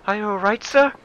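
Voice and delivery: high, concerned feminine voice; woman's voice. Acted. Female secretary checks on the boss, high put-on voice. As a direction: pitch it up as a worried assistant. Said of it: "that was a woman".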